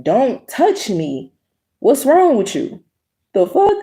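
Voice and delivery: reenacting the shock at him; incredulous outburst. Retelling outrage at supervisor.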